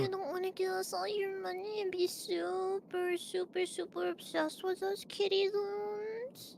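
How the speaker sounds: high, sugary streamer voice, drawn-out; cute VTuber voice. Pretend. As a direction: coo it like a cute anime streamer. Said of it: "I can just pull out that voice".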